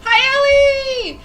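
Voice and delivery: high, loud, overjoyed greeting; excited squeal. Real, not acted. Streamer greeting a friend in chat, squealing happily.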